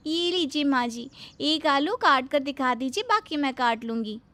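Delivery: high, syrupy and eager; sugary bahu voice. Acted. Bahu handing her mother-in-law the knife, sweetly eager. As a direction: innocent helpfulness, laid on thick.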